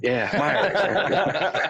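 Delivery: high, laughing imitation of wife's twang; imitated Southern twang. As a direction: play the wife's twangy reply through laughter. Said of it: "And she's like".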